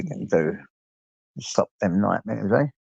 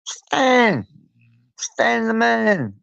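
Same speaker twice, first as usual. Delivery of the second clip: much higher and louder, last part slowed and drawn out; delighted shout; 13 semitones higher, 4 dB louder, much slower than usual. Real, not acted. Greeting a viewer he hasn't seen for a while, delighted shout.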